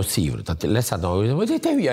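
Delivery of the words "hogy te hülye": sudden high-pitched cry of alarm; retelling a scare after landing at altitude; shocked exclamation. Retold or imitated. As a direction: blurt it out in a panic, voice jumping up. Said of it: "Hát én azt mondom".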